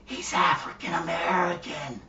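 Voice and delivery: hushed, secretive stage whisper; mock whisper. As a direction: whisper it as into someone's ear. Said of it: "whisper in the air".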